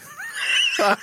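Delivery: sudden high playful yell on one word. Real, not acted. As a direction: throw the last word out in a high mock shout. Playful accusation.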